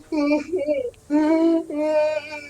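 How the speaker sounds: high, drawn-out fake sobbing; hammed-up high boo-hoo; mock crying. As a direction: wail like a weeping ghost. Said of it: "And he's kinda crying. He's like".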